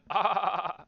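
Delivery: dopey stoned surfer chuckle; a laid-back surfer-dude laugh. Shown as a demonstration. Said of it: "Like the surfer laugh".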